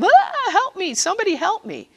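high frantic call for help; mock panicked cry. An overwhelmed person running out, crying for help. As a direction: wail it like someone overwhelmed.

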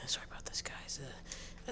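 soft whispered sorry; hushed apology. Real, not acted. Apologizing to viewers.

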